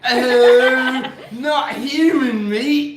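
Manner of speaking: high, drawn-out tiger voice; tiger accent. Acted. A tiger refusing human meat, in a high, slow put-on accent. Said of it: "my tiger accent sounds like that".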